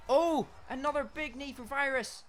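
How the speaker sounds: high, loud play-by-play yell; excited shout. Real, not acted. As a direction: call the hit with a burst of excitement. Excited fight commentary.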